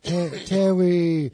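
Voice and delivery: imitating a sick toddler calling Terry. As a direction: drag out the name like a sick toddler. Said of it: "he's like".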